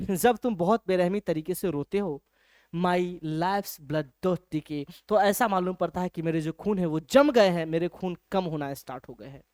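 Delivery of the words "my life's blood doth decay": very slow, soft recital; slow poem reading. Shown as a demonstration. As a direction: stretch each word of the verse. Teacher recites poem line.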